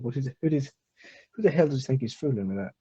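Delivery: ordinary talk, nothing marked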